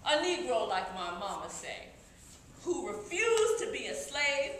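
ordinary talk, nothing marked

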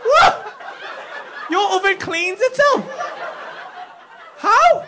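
high, loud incredulous yelps; can't believe what he's hearing; loud high disbelieving what; shocked disbelief. Real, not acted. Comedian shocked by self-cleaning oven.

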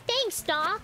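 high, loud mock gratitude; sarcastic thanks. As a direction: thank the doctor with heavy irony. Sarcastic reply to bad news.

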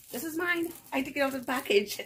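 high, quickening, amused squeal; amused realisation. Real, not acted. Vlogger realising the item is hers, amused. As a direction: pitch it high, about to laugh.

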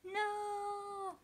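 high, despairing cry; horrified cry. Acted. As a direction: wail in dismay. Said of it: "screamed the veggies".

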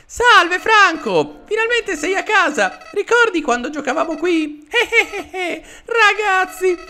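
squeaky falsetto flower creature, gleeful giggle; very high cartoon voice, chuckling as if greeting an old playmate; Flowey. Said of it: "la voce sarà difficile da fare". (vocina acutissima e allegra, con risatina)

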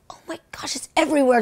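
hushed, breathless whisper. Retold or imitated. Sitting at the back during the sermon, whispering. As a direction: whisper it so no one nearby hears.

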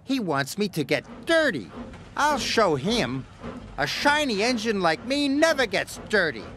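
high, indignant outburst; furious. A narrator's reading. James realises Gordon tricked him, high-pitched indignation. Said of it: "James was furious".

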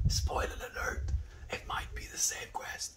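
whispered aside to chat; whispering. Real, not acted. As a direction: drop to a secret whisper.

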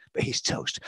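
soft whisper to close the verse; whispered punchline. Pretend. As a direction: drop to a whisper on the last word.

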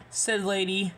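usual speaking voice

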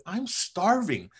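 pleading to his wife for a sandwich; drawn-out plea. Retold or imitated. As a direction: stretch it out like you're famished. Begging wife for food.

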